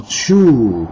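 long drawn-out, falling whoosh; whoosh sound effect. A narrator's reading. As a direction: imitate smoke shooting out of a gourd. Imita o som da fumaça saindo.

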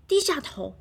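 ordinary delivery

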